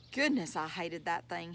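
ordinary delivery, nothing marked

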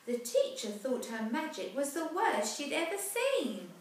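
put-on lively rhyming read-aloud for children; storybook narration. A narrator's reading. Parent reading a rhyming picture book aloud, lively. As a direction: tell the rhyme brightly to a child.

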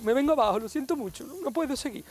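high, wobbly fake sobbing voice, far above his own; mock crying. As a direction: ham up breaking down in tears over the squid. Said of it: "Mira, ya estoy llorando". (llanto de broma exagerado y agudo, como si se derrumbara)

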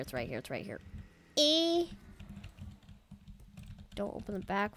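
usual speaking voice

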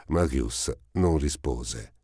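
ordinary delivery

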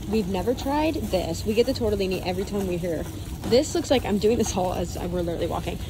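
ordinary talk

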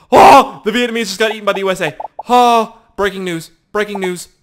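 high, loud alarm; mock breaking news. Gamer announcing Vietnamese player got eaten, mock alarm. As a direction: shout a hammed-up news flash.

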